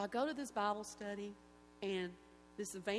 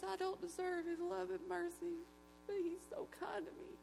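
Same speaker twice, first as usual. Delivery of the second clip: weeping; 9 semitones higher than usual. Retold or imitated. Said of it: "starts weeping and praising God".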